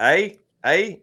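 "A" as a high, rising question; loud, lifted "A", asking for agreement; questioning Canadian eh. Shown as a demonstration. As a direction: pitch "A" up like a Canadian tag question. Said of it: "that's a Canadian thing".

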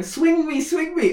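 put-on high childlike swing me; imitating a little girl begging to swing; child pleading. Daughter begging dad to keep swinging her, high and pleading. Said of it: "she's going".

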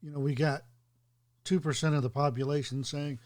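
ordinary talk

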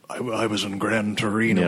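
a hint of a low-register screen veteran; Clint Eastwood. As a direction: lightly imitate an Irish version of the Gran Torino star. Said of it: "like, this lower".

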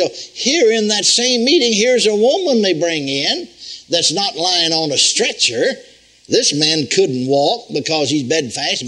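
normal talking voice

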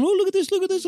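put-on high, sing-song baby talk; playful mum. Mum dangling a lure before her baby, high sing-song coo. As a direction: coo at the baby to make him look. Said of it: "And she was going".

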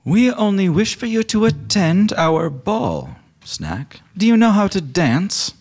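high, slow lich-lady voice; Carol Wisherspoon. Acted. Lich woman invites Snack to the ball, high and stately. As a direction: draw out a high, formal invitation. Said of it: "Carol says".

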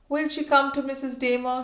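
mildly tremulous plea; trembling. A narrator's reading. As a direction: ask it with understated agitation.

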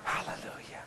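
whispered hallelujah; a hushed hallelujah under the music. Real, not acted. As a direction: breathe it almost silently. Soft praise after whisper.

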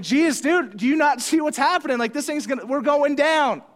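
high, loud mock panic; acted-out frantic plea on a sinking boat; panicked disciples. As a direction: wake him in hammed-up alarm. Apostles wake Jesus in the storm.

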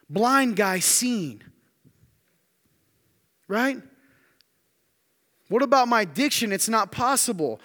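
high, loud, driving pulpit voice; preaching at full force. Acted. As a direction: preach it out with rising fire. Preacher building to a climax.

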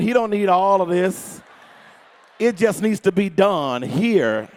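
low, very slow, drawn-out resentment; grudging, mad grumble. Acted. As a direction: grumble it low over the cooking. Acts wife grudgingly cooking mad.

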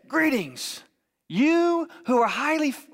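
high, grand mock angel proclamation; announcing angel. Angel appearing out of nowhere to Mary, grand high proclamation. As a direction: proclaim it loftily from on high, then get cut off. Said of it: "this angel shows up".

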